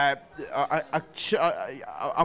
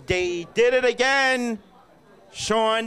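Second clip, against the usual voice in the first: exasperated; 6 semitones higher, 3 dB louder, slower than usual. Real, not acted. Exasperated complaint about restaurant.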